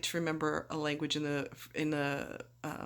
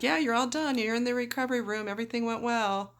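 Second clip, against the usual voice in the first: nurse voice; 6 semitones higher than usual. Retold or imitated. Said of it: "And they're like".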